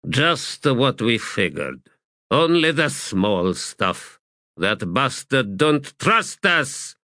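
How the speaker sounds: slow, drawn-out sapper grumble; disgruntled sapper. Acted. Shaman to corporal over opened crates, disgruntled. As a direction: complain slowly, word by word.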